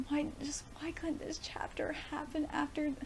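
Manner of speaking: high, hushed, breathy plea; real upset. Real, not acted. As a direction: ask it weakly, close to tears. Upset by the character deaths.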